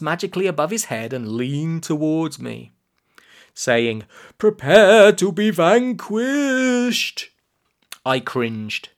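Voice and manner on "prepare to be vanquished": slow, high, loud pronouncement; grandly threatening, rising incantation; menacing villain. Acted. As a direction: draw it out like an evil wizard's spell. Villain about to sacrifice the boy.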